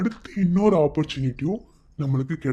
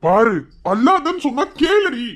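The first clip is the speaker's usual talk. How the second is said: loud, high, insistent plea; exasperated pleading; 10 semitones higher, 6 dB louder than usual. Acted. Husband telling wife to stop crying, exasperated. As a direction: raise your voice to make it sink in.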